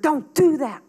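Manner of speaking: ordinary delivery, nothing marked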